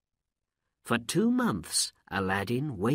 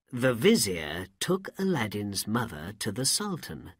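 ordinary delivery

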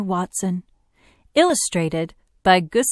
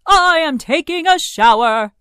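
high, loud trumpeted refusal; trumpeting elephant; 9 semitones higher, 8 dB louder than usual. A narrator's reading. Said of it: "said the trumpeting elephant".

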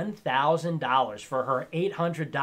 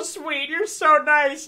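mock sweet voice; 9 semitones higher, much slower than usual. Mocking sweet reply.

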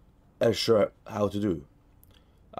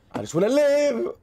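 whiny plea; 11 semitones higher than usual. Real, not acted. High pleading whine.

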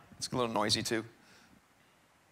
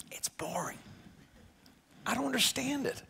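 hushed whisper, as if admitting a secret; mock whispered confession; 4 semitones higher, 14 dB quieter than usual. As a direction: breathe it out like a guilty secret. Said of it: "and you're like".

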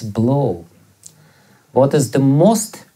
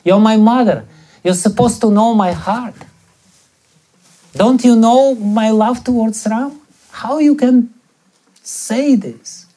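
higher, insistent hurt pleading; pained reproach; 6 semitones higher than usual. Retold or imitated. Bharata pleads with the mother who misjudged him, pained reproach. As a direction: reproach her as a wounded son. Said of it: "becomes so pained".

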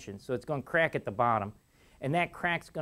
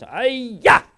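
sharp mock martial-arts yell, higher and louder; karate yell; 9 semitones higher, 12 dB louder than usual. An engineer at lunch splitting an apple, mock karate yell. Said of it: "And he holds it like this and goes".

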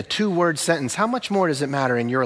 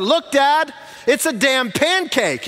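imitating a child shouting high across a restaurant; high-pitched mock shout, drawn out at the end; really loud; 9 semitones higher, slower than usual. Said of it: "said it a second time really loud".

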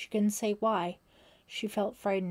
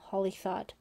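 ordinary delivery, nothing marked

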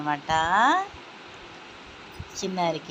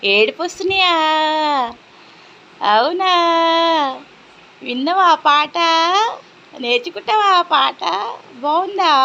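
higher sing-song cooing to an infant; 10 semitones higher, 4 dB louder than usual. Real, not acted. Mother soothes her fussing baby, high cooing baby talk.

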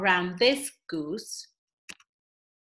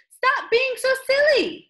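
much higher and louder pleading; hammed-up frantic plea; a pretend-panicked storyteller begging; mock panic; 11 semitones higher, 4 dB louder than usual. A narrator's reading. Narrator pleads against silliness.

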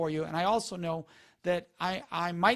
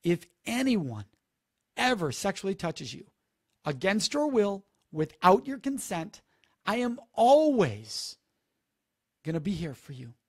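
slow, sincere and steady; calm reassuring pledge with a swell on always; slow tender reassurance; 5 dB louder than usual. Shown as a demonstration. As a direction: say it gently, like a promise. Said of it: "And say the following words".

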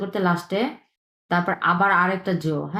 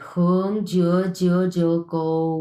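slow careful reading; slower than usual. Shown as a demonstration. Teacher slowly reads stroke names to the class. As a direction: read each stroke name slowly and evenly. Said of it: "এরপরে এটা দেখি".

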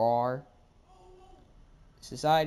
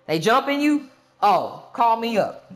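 eager backup; 8 semitones higher, 9 dB louder than usual. Acted. Eager friend offering backup.

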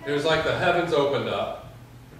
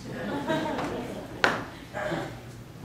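quick high chuckle at himself; high laugh; 11 semitones higher, 8 dB quieter than usual. Real, not acted. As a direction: laugh at the picture of it. Laughing at own story.

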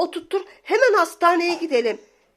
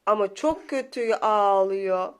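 mock pity; 4 semitones higher, slower than usual. Pitying the crying toy.

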